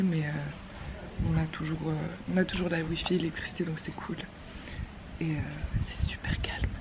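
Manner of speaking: soft whispered commentary; whispering. Real, not acted. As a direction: keep it hushed, barely voiced. Whispering on Balinese silence day. (chuchotement discret)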